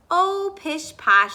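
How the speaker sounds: slow, loud, high pish posh, brushing it off; mother waves off the teasing, slow and loud; dismissive mother. A narrator's reading. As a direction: wave the worry away, drawn out. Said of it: "said her mother".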